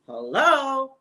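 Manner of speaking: high, loud, incredulous hello?; drawn-out disbelieving hello. Real, not acted. Exasperated aside about packed flight.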